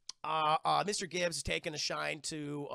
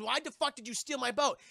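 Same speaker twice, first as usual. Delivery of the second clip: pissed off; 7 semitones higher than usual. Retold or imitated. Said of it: "She's just fucking pissed".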